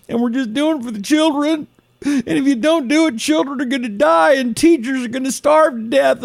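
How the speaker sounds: high-pitched mocking advocate voice; exaggerated high sob-story parody; mock pleading. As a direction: plead in a put-on high voice, hammed-up. Mocking pleas for property tax hikes.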